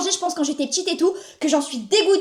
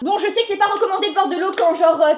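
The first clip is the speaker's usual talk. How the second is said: usual speaking voice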